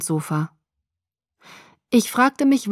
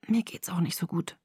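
faint, voiceless, much softer; whisper; 11 dB quieter than usual. Acted. Exhausted girl admits to Jacob she's unwell, faint whisper. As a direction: barely breathe the words. Sick girl answers weakly.

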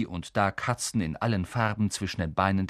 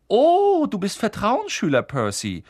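high, loud feigned astonishment; mock surprise; 11 semitones higher, 7 dB louder than usual. A narrator's reading. Twin teasing his brother Percy with hammed-up surprise. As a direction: act amazed to tease him. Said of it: "tat ganz überrascht".

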